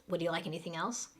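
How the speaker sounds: rapid, linked "Would you like anything else?"; really fast. Shown as a demonstration. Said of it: "Really fast".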